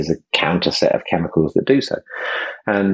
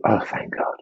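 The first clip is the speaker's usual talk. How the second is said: "Oh, thank God" breathed out in a soft whisper; whispered relief; 7 dB quieter, slower than usual. Shown as a demonstration. Host asks listeners to feel gratitude, with whispered relief. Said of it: "if you inhabit that feeling".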